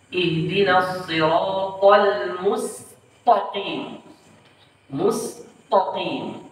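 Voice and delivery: very slow "mustaqim" with a heavy, rounded t; "mustaqim" said as "mustoqim"; thickened ta error. Shown as a demonstration. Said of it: "menebalkan huruf".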